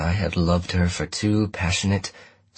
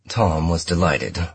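plain reading voice

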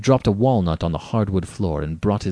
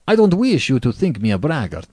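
quick, higher, louder disclaimer; hasty addition; 5 semitones higher, 5 dB louder than usual. A narrator's reading. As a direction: hurry to correct the impression. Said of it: "Then he added hastily".

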